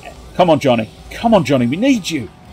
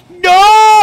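high, loud shriek; shocked cry; 8 dB louder than usual. Real, not acted. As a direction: shriek in dismay.

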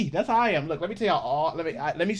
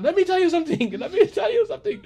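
high, put-on Indian accent; imitated Indian accent, pitched way up; 13 semitones higher than usual. Repeating the Indian guy's viral sound, high accented voice. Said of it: "my new one is the Indian guy".

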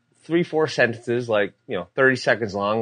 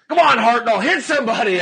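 much higher and louder taunting yell; heckling fan; 13 semitones higher, 8 dB louder than usual. Retold or imitated. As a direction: heckle him from the stands. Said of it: "some fan chirps down like".

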